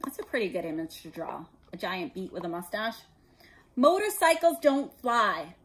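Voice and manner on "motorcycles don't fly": slow, loud, furious yell. A narrator's reading. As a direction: bark each word at the boy. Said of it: "yelled at Harry".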